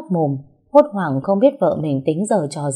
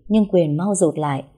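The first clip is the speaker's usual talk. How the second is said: normal talking voice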